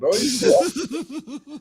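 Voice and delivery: put-on high, slow hammy British accent; hammed-up British accent sliding Caribbean; mock British accent. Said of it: "all my accents sound like a Caribbean accent".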